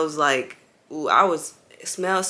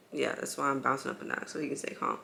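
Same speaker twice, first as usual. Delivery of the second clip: hushed whisper; 8 dB quieter, faster than usual. Real, not acted. Mother bouncing her baby, whispering so he stays calm. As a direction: breathe it out without voice.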